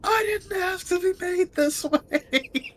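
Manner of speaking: very high, slow, hammed-up lament; mock anguished wail. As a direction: wail it like a melodramatic victim. Player wails as mutilated squirrel.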